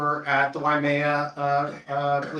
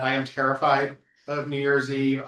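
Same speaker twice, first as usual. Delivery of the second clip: usual speaking voice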